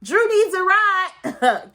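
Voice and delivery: high, loud mock voice stretching the words; mocking Marlo. Mimicking Marlo calling out to Kenya, high and loud. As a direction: squeal it out like a messy friend. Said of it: "She's like".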